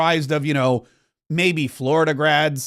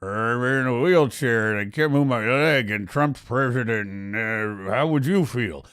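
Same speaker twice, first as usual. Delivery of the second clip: put-on low, slurred mumble of a feeble old politician; put-on feeble old-man voice, low and garbled; McConnell's imagined thoughts; 7 semitones lower, 4 dB quieter than usual. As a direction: mumble it as if barely able to form words. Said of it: "He's probably thinking".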